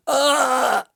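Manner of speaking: sharp high loud gasp and cry; jolted by a sudden fright; startled gasp. Real, not acted. Reading the chat reply aloud, he gives a startled gasp.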